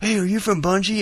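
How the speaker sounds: lightly voiced, high-pitched fan question; a hint of a starstruck stranger; imitated eager fan. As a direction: ask like a hopeful fan. Acting out an eager fan's question.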